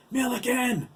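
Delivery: high, loud challenge; bellowing. A narrator's reading. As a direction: bellow a name across the road. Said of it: "Lyle bellowed".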